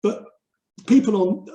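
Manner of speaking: ordinary delivery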